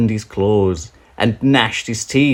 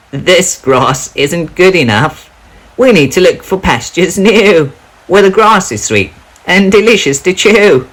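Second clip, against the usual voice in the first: high, bright-eyed goat voices; the goats grumbling for better grass; billy goats; 11 semitones higher, 9 dB louder than usual. Acted. Three billy goats complaining about the grass, voiced high.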